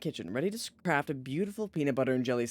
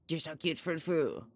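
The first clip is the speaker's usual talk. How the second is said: cooing; 4 dB quieter than usual. Real, not acted. Cooing at cat.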